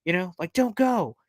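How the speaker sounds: high, begging whine; mock desperate plea through an intercom; pleading into intercom. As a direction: beg her to stay, voice climbing high. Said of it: "runs over to the intercom and goes".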